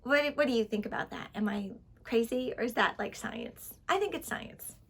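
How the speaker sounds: ordinary talk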